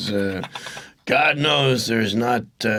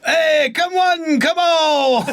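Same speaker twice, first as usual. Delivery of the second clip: hype call; 4 dB louder, slower than usual. Pretend. Hyping mock ad.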